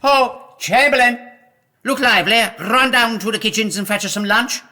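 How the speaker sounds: loud, high summons to a servant; calling out. Acted. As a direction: bellow across the castle for the chamberlain. King calls servant from afar.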